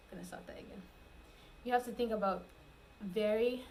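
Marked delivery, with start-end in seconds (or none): 0.1-0.8 much softer, almost voiceless aside; quiet aside. Real, not acted. Speaker to self before redoing the take, quiet aside. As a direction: drop right down, as if off the record.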